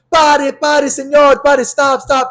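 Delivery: high, loud bilingual pleading; retold frantic yelling. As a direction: cry out to the boat driver. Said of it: "she starts being like".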